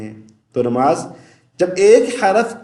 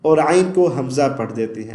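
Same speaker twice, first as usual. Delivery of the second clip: usual speaking voice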